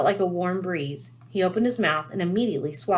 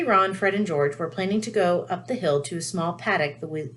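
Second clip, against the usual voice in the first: plain reading voice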